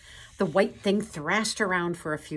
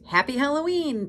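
higher, louder cheerful cry; Pooh calling out; 9 semitones higher, 6 dB louder than usual. A narrator's reading. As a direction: call it out to be heard through the door. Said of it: "Pooh cried".

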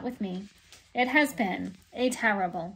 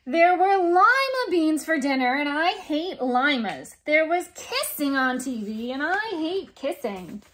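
indignant; 5 semitones higher, 5 dB louder than usual. Acted. Indignant complaints about dinner.

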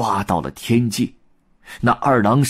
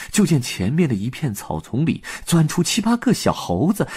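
usual speaking voice

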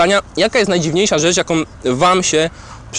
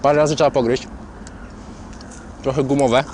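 mouth full; 2 semitones higher, 3 dB quieter than usual. Real, not acted. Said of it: "Przepraszam, że mówię z pełną buzią".

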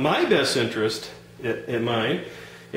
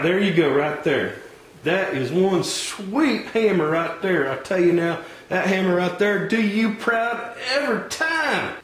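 higher, folksy Southern salesman voice; folksy hardware clerk; 6 semitones higher than usual. Acted. Clerk pushing the wrong tools on a customer, folksy higher voice. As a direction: play the pushy country store clerk.

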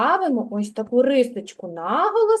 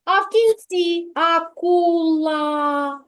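акула slowed, кінці leaned on; slow pointed teacher emphasis; drawn-out emphasis; 3 semitones higher, slower than usual. Shown as a demonstration. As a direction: stretch the phrase for the children. Teacher stresses final sound.

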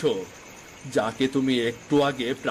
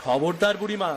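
stern warning; 5 semitones higher than usual. Acted. Warning the old woman sternly.

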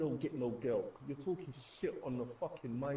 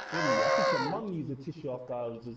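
high, loud trash-talk yell; shouting; 14 semitones higher, 7 dB louder than usual. Real, not acted. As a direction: bellow the insult.

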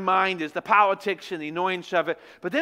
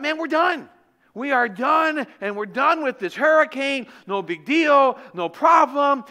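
mock triumph; 6 semitones higher, 5 dB louder, slower than usual. Triumphant relief after escaping storm.

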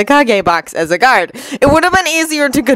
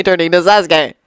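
ordinary delivery, nothing marked

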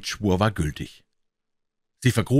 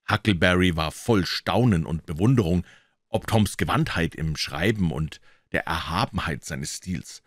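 usual speaking voice

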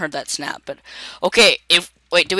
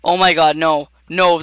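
usual speaking voice

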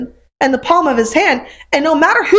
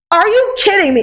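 raised, incredulous retort; mock outrage at God's question; half-joking indignant snap; angry disbelief; 7 semitones higher than usual. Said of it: "It was anger".

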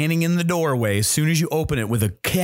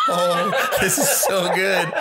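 high, slow, laughing relish; delighted onlooker; 9 semitones higher, slower than usual. Acted. GM voicing Chamberlain enjoying the show, laughing delight. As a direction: savor it, giggling and pitched up. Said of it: "Chamberlain just looking like".

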